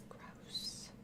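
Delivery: breathy whisper of disgust. Real, not acted. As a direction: whisper it, grossed out. Disgusted at head in bag.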